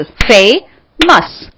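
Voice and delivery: Famous in two slow, separate beats; split into syllables. Shown as a demonstration. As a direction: break famous as फे, pause, मस. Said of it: "टू syllable.". (Famous को दो हिस्सों में तोड़कर)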